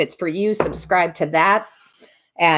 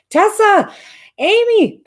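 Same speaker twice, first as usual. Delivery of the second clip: excited greeting; 6 dB louder, slower than usual. Real, not acted. Greeting viewers joining the live.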